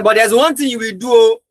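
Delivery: emphatic retold warning. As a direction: warn her with rising force. Warning girlfriend, voice raised.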